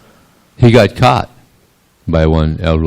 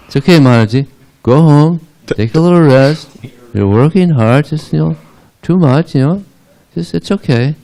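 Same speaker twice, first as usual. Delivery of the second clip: high-pitched motherly consoling; acted reassurance; 9 semitones higher, 4 dB louder than usual. Mother Yasoda sending tired gopi home, reassuring. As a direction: soothe her in a raised, insistent voice.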